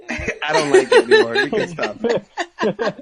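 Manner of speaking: real laughter through high, breaking words; laughing. Real, not acted. Host calling off the squeal attempts, laughing helplessly. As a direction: say it while cracking up.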